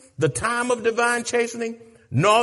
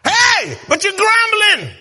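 high, loud chanted shout; preacher whooping at the congregation; 14 semitones higher, 8 dB louder than usual. Acted. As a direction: hammer each line like a drumbeat. Preaching climax.

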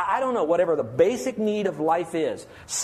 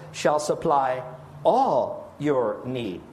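"all" stretched out slow and weighty; stress on all; 3 semitones lower, much slower than usual. Shown as a demonstration. Pastor to congregation, stressing "all" slowly. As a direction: lean on "all your need", drawn out. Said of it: "let's look at the scope of this".

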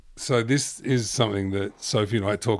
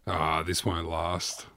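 low, soft, slow sigh of acceptance; voiced shrug, drawn out and subdued; resigned balloon person; 6 semitones lower, 6 dB quieter, slower than usual. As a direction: imitate quiet resignation. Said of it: "you're probably like".

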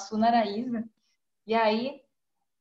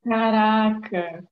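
slow, louder caraca; drawn-out amazement; 6 dB louder, much slower than usual. Real, not acted. Friend hears about the broken fin, slow stunned caraca. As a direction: stretch the word in disbelief.

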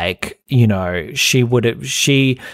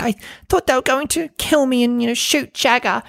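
high, breathy-free mock panic in a much higher voice; hammed-up imitation of a terrified housewife; mocking Dorit's dramatic retelling; 13 semitones higher than usual. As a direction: overdo her frightened retelling, pitched way up. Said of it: "really dramatic retelling".

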